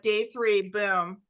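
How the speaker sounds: ordinary delivery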